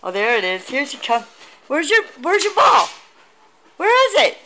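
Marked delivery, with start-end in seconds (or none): 1.7-4.4 high, teasing question to the puppy; play-search with the dog, voice up high; high playful dog talk. Real, not acted. Owner teasing the puppy about her ball, high and playful.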